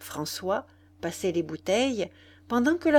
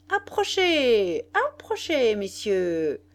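barker's repeated call; 3 semitones higher, slower than usual. A narrator's reading. La Faloise calling gentlemen over, barker's patter. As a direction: beckon the crowd with stretched vowels. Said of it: "répétait la Faloise".

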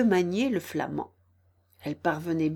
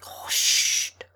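unvoiced frightened shush; hushed, frightened. A narrator's reading. Frightened rabbit silencing Alice's laughter, whispering. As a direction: hush in a scared whisper. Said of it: "tout bas le lapin d'un ton effrayé".

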